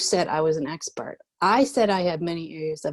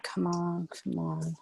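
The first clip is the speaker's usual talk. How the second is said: muttering; 9 dB quieter than usual. Acted. Muttered under pressure.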